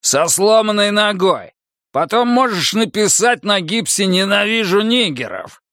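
high-pitched scornful menace; angry threat. A narrator's reading. Buddy keeps threatening his passenger, angry and scornful. Threat continues after bark.

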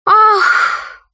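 one high cry as the dog; talking dog. A narrator's reading. Dog answers while wagging its tail, high exclamation.

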